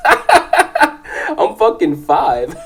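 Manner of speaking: real laugh breaking into the words; amused storyteller losing it to laughter. Real, not acted. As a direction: crack up while telling it. Laughs at his own childhood story.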